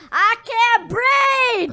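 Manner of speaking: high, loud, drawn-out pretend shout; mock yell. Guest acts out a headlock victim's cry, hammed-up long shout. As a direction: yell it out slowly, hamming it up. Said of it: "Yell out".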